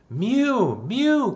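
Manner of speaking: high, loud cat cries; loud high meowing; crying cat. A narrator's reading. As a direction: cry mew like a cat. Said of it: "cried Simpkin".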